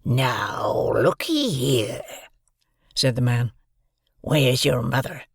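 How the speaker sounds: put-on loom over the boy and growl it; menacing convict. A narrator's reading.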